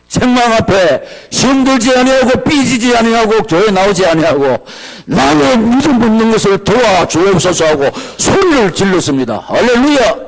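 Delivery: high, shouted proclamation; full-force preaching. Real, not acted. Preacher telling how the rebuked father cried out, shouting fervently. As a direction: cry out at the top of the register.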